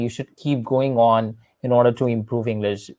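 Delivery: ordinary delivery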